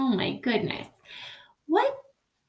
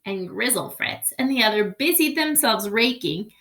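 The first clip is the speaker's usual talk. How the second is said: usual speaking voice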